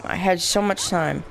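normal talking voice

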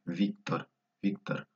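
"Víctor" with a Russian V; initial V sound. Shown as a demonstration. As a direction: start "Víctor" on V, teeth on lip. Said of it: "se lee como V".

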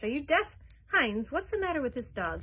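put-on loud, irritated old duke; impatient master barking questions; gruff scolding. A narrator's reading. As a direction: snap at the servant.

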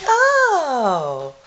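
high startled "Oh!"; surprised gasp. Retold or imitated. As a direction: act caught out. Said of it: "They're like".

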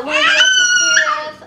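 squealing with excitement; excited shout. Real, not acted. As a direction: burst out excitedly. Excited kid describing shark.

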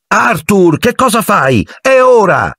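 high, urgent call; wife calling husband to the departing train, high shout. Acted. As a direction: hurry him up from afar.

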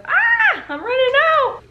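high, loud startled ah; surprised yelp. Real, not acted. Putting on cream, surprised yelp at running out.

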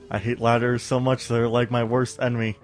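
normal talking voice

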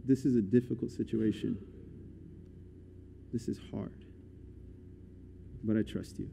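very soft, low confiding; low, near-whispered admission of trust; hushed prayer. Acted. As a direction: confide it quietly to God.

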